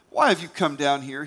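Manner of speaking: sharp, loud demand; barking angrily. A narrator's reading. Older brother scolding David, angry loud bark. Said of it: "he barked".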